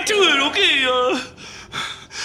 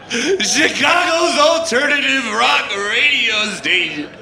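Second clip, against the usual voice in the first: DJ voice breaking into laughing sobs, higher; on-air breakdown; 4 semitones higher, slower than usual. Acted. Dumped DJ on the air to Chicago, voice cracking. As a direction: keep the radio patter while falling apart.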